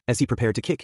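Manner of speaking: ordinary delivery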